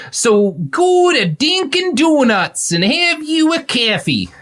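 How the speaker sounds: very high, stretched mock-Boston accent; absurdly mangled Boston squawk; bad Boston accent. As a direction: ham up an awful Boston accent, slowly. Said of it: "That was my Boston".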